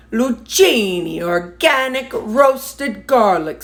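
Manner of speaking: Lucchini said loo-CHEE-nee, slow and drawn out; her own Lucchini. Shown as a demonstration. Cooking for viewers, saying Lucchini her own way, slow. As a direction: show how Lucchini sounds in her head, slowly. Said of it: "this is how I would say it".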